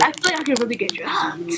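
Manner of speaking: hushed unvoiced whisper. Real, not acted. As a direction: lean in and whisper it. Confiding fear to friends.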